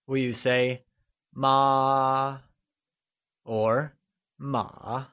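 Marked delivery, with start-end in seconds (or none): 1.3-2.4 "ma" held long on one level pitch; high level tone. Shown as a demonstration. Teacher offers a quiz choice, "ma" in high level tone. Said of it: "constant high-level tone". 4.4-5.1 "ma" soft, dipping and rising; falling-rising tone. Shown as a demonstration. Teacher offers a quiz choice, "ma" in falling-rising tone. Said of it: "falling rising tone".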